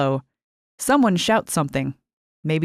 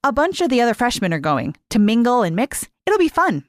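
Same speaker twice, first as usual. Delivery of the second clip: higher, bright selling of the party; upbeat roommate; 6 semitones higher than usual. Acted. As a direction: pitch the party with rising cheer.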